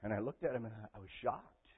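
normal talking voice

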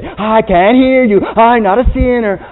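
put-on loud, high, slow childish refusal; stubborn sing-song denial; mock denial. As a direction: fingers in ears, drowning out the truth. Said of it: "put my fingers in my ears and say".

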